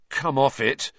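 usual speaking voice

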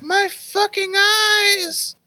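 very high, drawn-out mock scream; mom screaming. Storyteller imitating mom screaming from the other room, high mock scream. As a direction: wail it in a falsetto mom voice, slowly. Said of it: "hear my mom scream from the other room".

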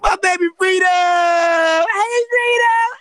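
high, loud squeal of excitement; excited shout. Real, not acted. Greeting beloved Freedom, excited shouting. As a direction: shout it high and thrilled, stretching the name. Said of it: "I'm so excited!".

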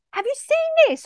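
high-pitched thrilled call; excited shout. Retold or imitated. Teacher waving the results at everyone, high excited call. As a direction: squeal it to the whole room. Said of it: "I shouted".

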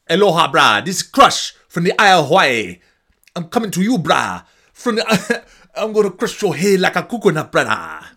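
loud, high hammed-up Hawaiian wrestler; over-the-top fake Hawaiian accent, shouted; horrible Hawaiian accent. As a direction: cut a wrestling promo in bad Hawaiian English. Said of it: "having, like, horrible accents".

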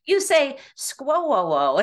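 drawn-out Midwest squirrel as skwoo-oo; slow, laughing mock of a Midwest squirrel; mocking Midwest accent. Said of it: "she's like".

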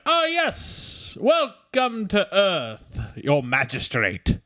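very high, very slow pompous welcome; hoity-toity villain voice. Retold or imitated. Host imitating Travolta's alien welcoming the magistrate, pompous. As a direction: stretch it like a snooty alien lord. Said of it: "why is he talking like that".

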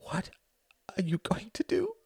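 high, hushed whisper with a halting start; frightened mother. A narrator's reading. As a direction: whisper the question in dread.

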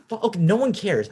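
high, exasperated scoff; exasperated outburst. Real, not acted. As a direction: pitch jumps way up, fed up.

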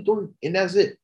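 ordinary delivery

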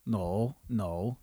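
usual speaking voice

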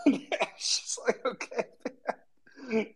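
talking through real laughter; high, slow, breaking up with laughter; laughing. Real, not acted. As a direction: laugh helplessly through the words. Laughing at his own impression.

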